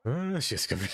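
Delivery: normal talking voice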